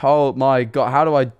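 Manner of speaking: ordinary delivery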